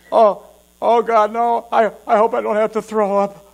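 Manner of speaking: groaning, about to be sick; hammed-up nausea in a strained voice; acted queasiness. Diner squeezed by his undershirt, queasy and groaning. Feels sick in tight shirt.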